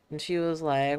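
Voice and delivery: ordinary talk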